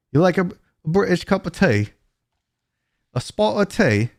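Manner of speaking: light mock British accent. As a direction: put on a playful British accent for the tea question. Reading a chat question about British tea.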